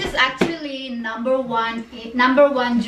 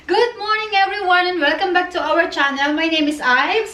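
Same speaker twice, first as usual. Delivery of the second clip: upbeat channel greeting, pitched up; vlog host intro; 5 semitones higher than usual. Acted. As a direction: welcome the viewers like a host.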